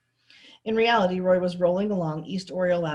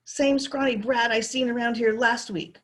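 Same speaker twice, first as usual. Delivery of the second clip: complained; 5 semitones higher, 4 dB louder than usual. A narrator's reading. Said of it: "Curly complained".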